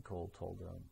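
ordinary delivery, nothing marked

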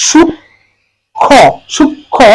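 সূক্ষ্ম broken slowly as "shuk-kho"; teacher sounding out সূক্ষ্ম syllable by syllable; demonstrated pronunciation. Shown as a demonstration. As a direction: say সূক্ষ্ম in two drawn-out parts, m silent. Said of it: "তাহলে এটা উচ্চারণ হবে".

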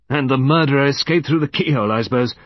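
brisk, sceptical suggestion; quick, doubting sarcasm. A narrator's reading. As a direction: offer it as an absurd theory. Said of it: "said Colonel Berry skeptically".

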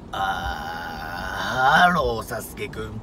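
creaky low fry before the phrase; vocal fry. Shown as a demonstration. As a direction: rattle the fry, then speak. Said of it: "こういう声を普通の声に混ぜると". (エッジボイス混じりの声)

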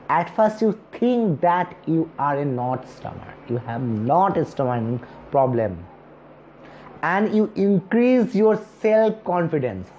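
normal talking voice

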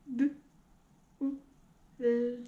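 sounds split one by one; robot voice. Shown as a demonstration. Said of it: "on le dit en robot". (voix de robot, sons séparés)

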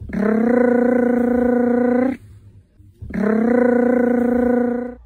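strong rolled rrr; trilled r. Shown as a demonstration. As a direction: roll "Rrr" hard and loud. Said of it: "repasemos nuestros sonidos". (erre vibrante fuerte)

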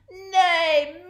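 high, very loud neigh; deafening horse neigh. A narrator's reading. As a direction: neigh at full voice. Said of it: "let out a deafening sound".

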